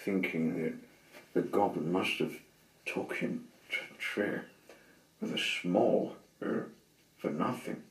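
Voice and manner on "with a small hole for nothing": plain reading voice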